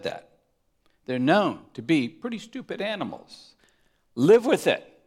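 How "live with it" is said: louder and higher live with it; mock exasperated shout. Real, not acted. Preacher tells the congregation to accept being sheep, loud and high. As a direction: throw it out at them, half joking.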